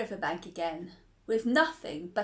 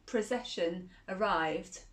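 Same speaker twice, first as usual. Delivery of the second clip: plain reading voice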